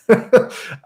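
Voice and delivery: short, high breath of laughter; self-mocking laugh. Real, not acted. Streamer admitting he's desperate, short laugh. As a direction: laugh at your own desperation.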